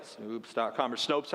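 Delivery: low, slow admission; sheepish aside. Retold or imitated. Preacher admitting his first response to miracles, sheepish aside. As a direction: drop the pitch right down, slowing.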